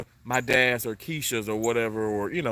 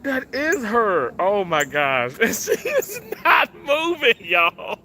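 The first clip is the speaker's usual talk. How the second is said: high, loud, drawn-out happy tears; overcome, crying; 13 semitones higher, 6 dB louder, slower than usual. Real, not acted. Vlogger sees mom's sign in a cardinal, tearful wail. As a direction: wail in joy through tears and laughter. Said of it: "I'm sitting here crying".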